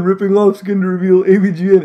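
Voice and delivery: ordinary talk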